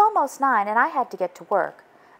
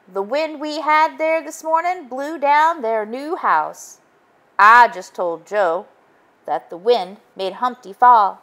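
higher, slow goose-like chief voice; 5 semitones higher, 5 dB louder than usual. Acted. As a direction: Goose apologizing to Joe. Police Chief Goose speaks.

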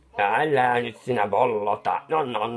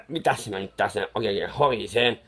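ordinary delivery

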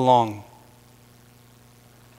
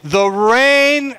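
drawn-out, higher proclamation; loud heavenly proclamation; 5 semitones higher, 4 dB louder than usual. Acted. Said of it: "there came to be loud voices of heaven".

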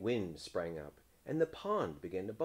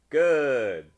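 loud, higher exclamation; hearty townspeople; 5 semitones higher, 15 dB louder than usual. A narrator's reading. Townspeople approving the frog's offer, loud hearty voice. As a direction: boom out one drawn word. Townspeople accept his service.

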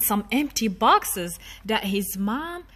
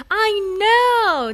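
put-on high, bright child exclaiming; light up with an idea; excited little boy; 8 semitones higher, 4 dB louder than usual. Daniel getting an idea, high excited child voice. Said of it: "Daniel said".

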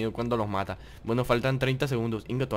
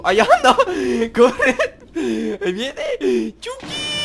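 high, loud panicked laughing yells; being chased; 11 semitones higher, 8 dB louder, much slower than usual. Real, not acted. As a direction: scream it while running away. Fleeing the killer in game.

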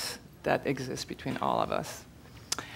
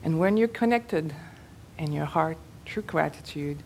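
measured meditation leader with long pauses; spacious, unhurried phrasing for a group meditation; guided meditation. Real, not acted. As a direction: guide them phrase by phrase, leaving room to breathe.

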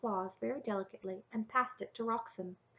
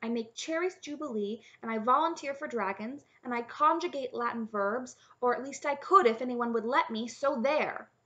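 pitched-up, defiant list of skills; feisty princess retort, building louder; snapping; 5 semitones higher, 5 dB louder than usual. A narrator's reading. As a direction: rattle off your defence, indignant. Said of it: "Cimmerian snapped".

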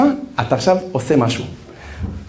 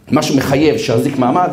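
usual speaking voice

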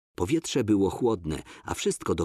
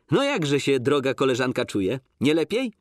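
hearty; 6 semitones higher, 4 dB louder than usual. Acted. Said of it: "zapytał serdecznie".

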